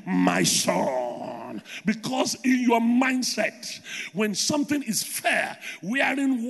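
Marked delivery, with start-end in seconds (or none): 0.1-1.6 very low, drawn-out holy voice; false voice of God. Acted. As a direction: put on a deep, stretched-out heavenly tone. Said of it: "and say to you".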